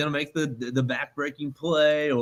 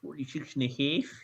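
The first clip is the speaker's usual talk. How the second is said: put-on Chicago accent; 8 dB quieter than usual. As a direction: say it like a Chicago sports fan. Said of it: "You're going with my Chicago accent".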